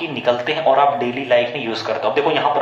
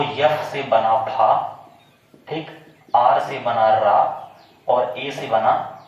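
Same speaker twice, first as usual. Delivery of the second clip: slow, syllable by syllable breakdown; slow decoding; much slower than usual. Shown as a demonstration. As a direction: spell it out slowly for the class. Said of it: "decode करूँ". (धीरे-धीरे अक्षर तोड़कर)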